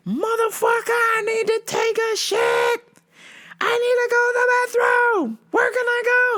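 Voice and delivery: very high, desperate customer screech; mock yelling. Customer pleading for the bathroom, mock yelling. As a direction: shriek the demand, hammed up. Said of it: "She was yelling".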